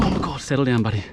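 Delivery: usual speaking voice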